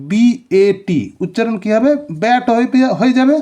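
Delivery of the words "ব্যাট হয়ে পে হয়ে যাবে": ordinary delivery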